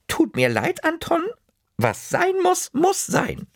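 hohe Omastimme, bestimmt und endgültig; strenge Oma. Acted. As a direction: sag es wie eine Oma, die nicht nachgibt. Oma bleibt streng beim Abwasch. (hohe Omastimme, bestimmt)

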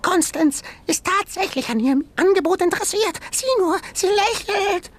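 very high, fast little animal voice; high fast creature voice; high squeaky creature. Acted. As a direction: chatter like a nervous jumping shrew. Sengi creature voice.

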